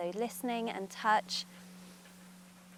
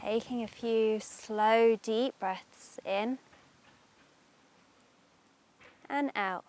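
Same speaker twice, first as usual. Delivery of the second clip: guided meditation; 3 dB louder than usual. Acted. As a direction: ease them into calm, unhurried.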